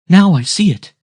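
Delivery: high, loud cry of sighting; excited cry. A narrator's reading. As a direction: cry out urgently, pitch leaping. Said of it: "she cried".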